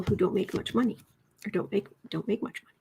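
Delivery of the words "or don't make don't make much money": quick unvoiced whisper; fast whisper. Real, not acted. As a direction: rush it in a whisper. Whispering beside the cat.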